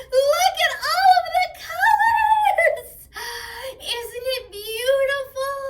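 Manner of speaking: high, loud squeals of delight; overjoyed. Acted. The unicorn cheering the kids for bringing color, overjoyed and loud. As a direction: burst out thrilled, almost singing. Character thrilled by new colors.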